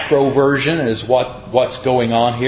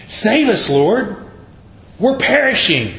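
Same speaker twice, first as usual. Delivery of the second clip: higher, urgent mock cry for help; panicked plea; 8 semitones higher than usual. Disciples waking Jesus in the storm, mock panicked cry. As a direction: cry out like sailors sinking in the storm.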